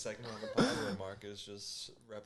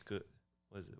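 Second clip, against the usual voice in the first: ordinary talk, nothing marked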